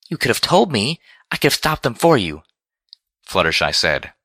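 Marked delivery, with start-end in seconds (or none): 0.1-2.4 high-pitched, hurt protest; meek voice pushed up in reproach; voice slightly raised. A narrator's reading. Fluttershy reproaching Applejack over the cockatrice, voice slightly raised. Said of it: "uncharacteristically raising her voice just a smidgen".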